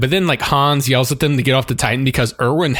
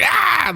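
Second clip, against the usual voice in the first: mock scream. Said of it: "and she's just like".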